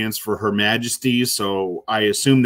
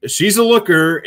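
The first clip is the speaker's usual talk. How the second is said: higher and louder, admiring; an appreciative, raised exclamation; excited emphasis; 9 semitones higher, 6 dB louder than usual. Real, not acted. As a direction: lean in and say it with relish. Repeating praise, voice jumps up.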